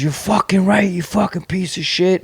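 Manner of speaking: moderately heated, retold insult; retold angry outburst. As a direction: act out a brother lashing back. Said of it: "he was trying to get out of the wheelchair to fucking attack".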